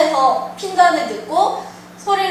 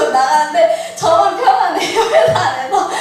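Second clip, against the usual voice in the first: higher, louder, breaking into laughter; laughing while talking; 5 semitones higher, 5 dB louder than usual. Real, not acted. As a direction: let real laughter bubble through the words. Amused at own office situation.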